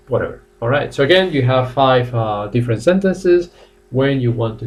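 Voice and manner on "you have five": normal talking voice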